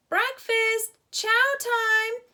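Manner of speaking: high, loud call to breakfast; yelling. A narrator's reading. Boys call each other to breakfast, loud yell. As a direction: holler it across camp. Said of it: "yelled the boys".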